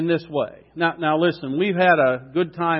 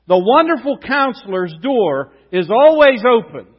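preaching; 3 semitones higher, 6 dB louder than usual. Acted. Proclaiming Christmas truths.

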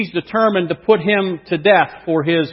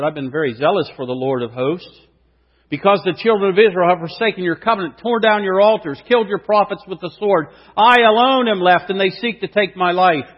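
fast, louder and higher, building to I alone; impassioned protest; 6 semitones higher, 5 dB louder than usual. Acted. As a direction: pour out the complaint with rising urgency. Elijah's complaint, read second time.

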